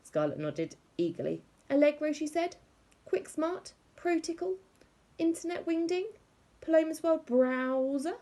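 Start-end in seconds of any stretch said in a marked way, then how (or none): 1.7-8.2 much higher, proud listing voice; know-it-all little girl. A narrator's reading. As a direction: show off every name you know.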